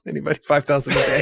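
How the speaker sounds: laughing through his joking price. Real, not acted. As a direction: chuckle out the price tag. Jokingly offering himself for hire.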